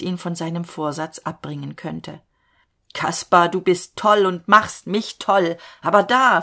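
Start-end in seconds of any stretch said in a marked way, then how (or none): 2.9-6.4 much louder, wild shout; raving shout. A narrator's reading. Falke shouts wildly at Kaspar, raving shout. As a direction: bellow at him, beside himself.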